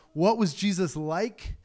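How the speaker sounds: ordinary talk, nothing marked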